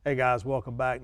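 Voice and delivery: ordinary talk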